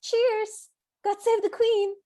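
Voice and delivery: high, loud British toast, blurted out; hammed-up British accent shouted on impulse; mock British cheer. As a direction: blurt it out like an over-eager Brit. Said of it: "Tessa almost compulsively goes".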